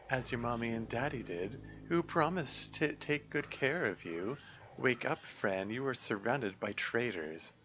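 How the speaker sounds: slow, creeping menace; slow sinister tempter. Acted. Dark voice turning the girl against her cat, slow and creeping. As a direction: lure her in slowly.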